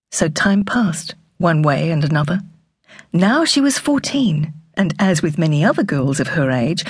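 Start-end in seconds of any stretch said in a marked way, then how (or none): none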